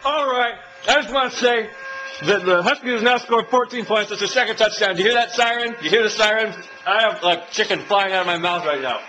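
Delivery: talking fast between bites; lively fan update; excited report. Real, not acted. Excited while eating.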